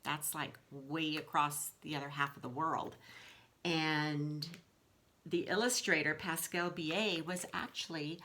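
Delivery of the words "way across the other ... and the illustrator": ordinary delivery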